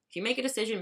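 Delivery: normal talking voice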